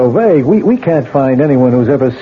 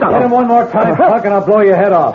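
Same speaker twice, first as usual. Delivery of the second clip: higher, sharp order to freeze; commanding threat; 7 semitones higher than usual. Acted. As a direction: stop him cold with a threat. Lieutenant bursts in, gun drawn.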